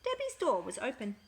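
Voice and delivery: plain reading voice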